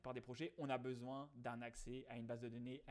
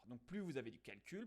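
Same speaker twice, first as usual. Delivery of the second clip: ordinary talk, nothing marked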